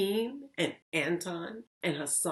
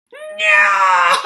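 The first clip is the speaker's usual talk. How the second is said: much higher, much louder, slow shouted goblin; 16 dB louder than usual. Acted. Goblin taunts Lily with a deal, high slow shout. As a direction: bellow it like a gleeful goblin. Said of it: "smiled the goblin".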